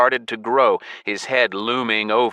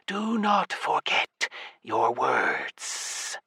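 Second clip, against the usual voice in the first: very slow, hushed serpent hiss; 3 semitones higher, 7 dB quieter, much slower than usual. Acted. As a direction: hiss it like a giant snake. Serpent god's parting warning.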